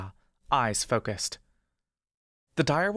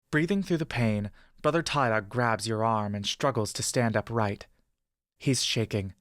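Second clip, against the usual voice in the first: ordinary delivery, nothing marked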